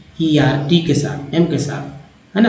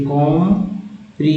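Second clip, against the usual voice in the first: broken into parts. Shown as a demonstration. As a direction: sound out "comprehensive" in separate chunks. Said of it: "चार word से एक बन गया".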